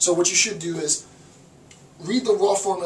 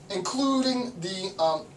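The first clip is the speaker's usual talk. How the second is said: usual speaking voice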